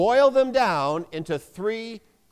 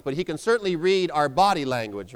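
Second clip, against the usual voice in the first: ordinary delivery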